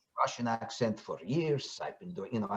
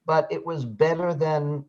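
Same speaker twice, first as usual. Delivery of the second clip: usual speaking voice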